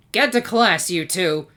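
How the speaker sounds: loud, high, barked command; snapped order. A narrator's reading. Teacher snapping at two students in the hall, loud and sharp. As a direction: snap at the students across the hall. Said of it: "snapped Mister Cranky Doodle".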